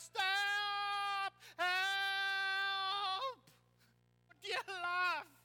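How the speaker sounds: high, loud cries of panic from the banana; retold panicked shout. Preacher retelling his ride, shouting for help in panic. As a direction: yell for help as if clinging on. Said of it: "I'm shouting on the banana".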